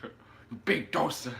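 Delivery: Tyson Fury. Acted. Co-host joins the boxer impression, slow mocking insult. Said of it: "they want a Tyson Fury impression".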